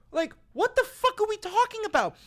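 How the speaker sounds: high, loud exasperation; exasperated high shout. Real, not acted. Vlogger shouting in disbelief about the Vatican talks. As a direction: yell it up high, fed up.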